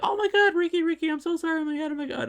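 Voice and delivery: very high-pitched panicked squeal, imitating her; mock freak-out, gushing apology in falsetto; frantic apologizing. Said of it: "she's apologizing profusely".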